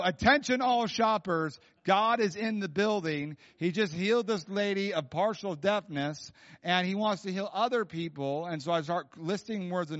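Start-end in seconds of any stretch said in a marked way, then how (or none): 0.0-8.5 higher, louder, announcer-style call over the PA; store intercom announcer. Retold or imitated. Preacher announcing a healing over the grocery intercom, loud announcer voice. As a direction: boom it out like a store intercom page. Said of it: "So I go".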